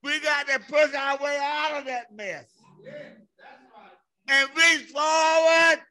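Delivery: fired-up preacher, punching the key words loudly; full-force preaching. Acted. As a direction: drive each phrase home to the congregation.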